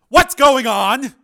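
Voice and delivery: loud, slow, demanding bark. A narrator's reading. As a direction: burst in and demand answers. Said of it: "Samuels demanded".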